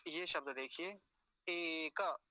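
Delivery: ordinary talk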